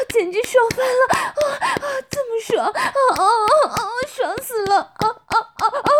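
high, loud moaning voice; wife moaning in pleasure. Acted. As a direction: gasp and cry out between words. Wife character in intimate scene. (高声娇喘呻吟)

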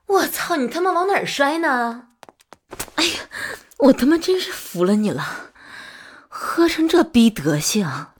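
loud, high-pitched angry scolding; exasperated cursing. Acted. Cursing a drunk partner who keeps falling over, exasperated. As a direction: swear at them in loud exasperation.

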